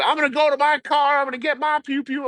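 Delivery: high, far put-on yokel voice; the local storming off to his car; mock angry local. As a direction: play the riled-up local threatening. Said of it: "Dude, turn".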